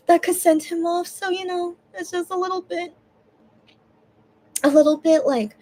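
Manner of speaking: words squeezed through tears; crying voice. Real, not acted. Thankful to the friend, speaking through tears. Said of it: "making me cry".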